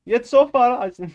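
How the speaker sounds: put-on high, loud Indian-accented reading. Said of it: "I can't do it like that".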